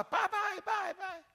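put-on little boy running in calling his father; child calling dad. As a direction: call out for dad like a small boy. Little boy bursts into room calling.

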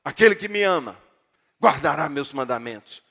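loud, forceful stern command; harsh legalistic reading. Shown as a demonstration. Preacher mimics people who read the Bible as law, stern and loud. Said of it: "e lê assim".

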